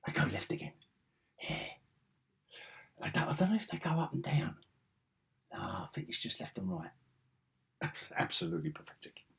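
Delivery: hushed, breathy play-by-play; whispered commentary. Real, not acted. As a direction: whisper it under your breath.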